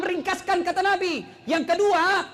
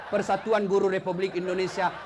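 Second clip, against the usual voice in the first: low monotone reading; droning read-out; 9 semitones lower than usual. Acted. Bored worshipper reading a calendar during long prayer, low drone.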